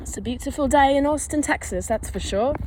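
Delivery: put-on, not quite convincing British accent; semi-British accent. Shown as a demonstration. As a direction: attempt a British accent, badly. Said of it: "that was a semi-British accent".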